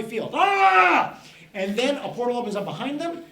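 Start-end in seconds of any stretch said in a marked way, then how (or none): 0.3-1.2 loud, high panicked-herd yell; hippo scream. Acted. Storyteller to children as monster hippos stampede, loud yell. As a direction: let out a big high "ah".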